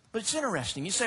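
ordinary delivery

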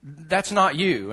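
slow deadpan repeat. Retold or imitated. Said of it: "She went".

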